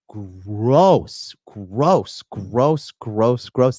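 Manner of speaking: drawn-out, repeated disgust. Real, not acted. Streamer recoiling at a cheating story, disgusted. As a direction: lean hard into each word in revulsion.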